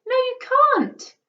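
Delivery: high, loud furious shout; a boy yelling at his father in rage; enraged boy screaming no. A narrator's reading. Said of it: "shouted at his father".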